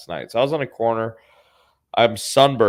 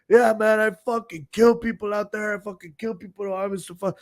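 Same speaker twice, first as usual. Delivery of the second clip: high-pitched rowdy brag, fading; strained high mock tough-guy voice; drunken boasting; 10 semitones higher than usual. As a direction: imitate a drunk insisting he's dangerous. Drunk passenger keeps boasting of killing.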